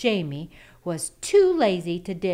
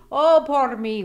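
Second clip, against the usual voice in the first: high, loud hammed-up wail; mock wail; 6 semitones higher, 9 dB louder than usual. A narrator's reading. Lazy husband wailing about starving, high and loud. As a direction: wail it like a self-pitying sluggard. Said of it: "wailed Jamie".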